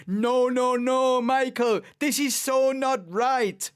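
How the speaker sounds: loud high pleading radio call, slow; team boss on the radio. Retold or imitated. Team boss begging Michael on the phone, loud and drawn out. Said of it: "you've got the team boss on the phone going".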